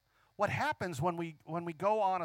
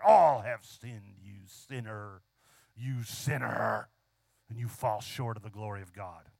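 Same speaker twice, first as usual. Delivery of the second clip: imitated hellfire preacher; 10 semitones lower, slower than usual. Mimicking a preacher accusing sinners, low condemning voice. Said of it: "some preachers really like to preach this".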